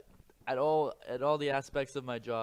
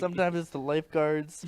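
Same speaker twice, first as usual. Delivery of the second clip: normal talking voice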